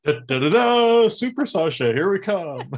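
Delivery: loud, high-pitched heroic announcement; superhero fanfare. Pretend. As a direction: belt it out like a caped hero's entrance. Mock superhero fanfare, playful.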